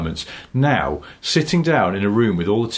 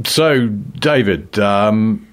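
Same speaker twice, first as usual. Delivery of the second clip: loud, slow anchor voice; news anchor; 6 semitones higher, 5 dB louder, slower than usual. Pretend. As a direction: ask the reporter live on air. Mock news anchor back.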